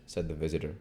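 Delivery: usual speaking voice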